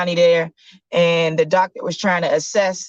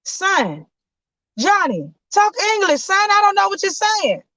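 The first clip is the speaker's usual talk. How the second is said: high, pleading dad voice; dad begging his son to talk English; baffled father. Acted. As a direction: beg your son to make sense. Father confused by Italian.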